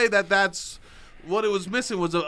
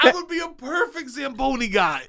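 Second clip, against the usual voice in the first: excited; 8 semitones higher, 4 dB louder than usual. Real, not acted. Excited self pitch.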